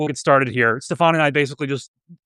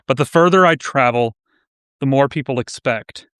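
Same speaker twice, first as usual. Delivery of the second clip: measured poetry recital, pauses after each phrase; poem reading; 3 semitones lower than usual. Real, not acted. Host reads a submitted Fallout-inspired poem to livestream audience, poem reading. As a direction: read it as verse, lowering at line ends.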